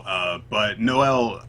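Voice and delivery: usual speaking voice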